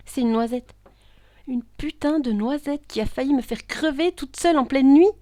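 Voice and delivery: loud, high, indignant outburst; outraged. Acted. Woman nearly killed by a hazelnut, outraged. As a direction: rant in disbelief, rising at the end.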